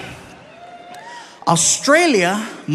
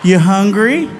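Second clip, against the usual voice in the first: put-on talking to a toddler; slower than usual. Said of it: "He talks to me like I'm two".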